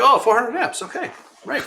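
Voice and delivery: hammed-up penny-dropping okay right; sudden realization. Owner realizes the engineer's point, mock surprise, very high.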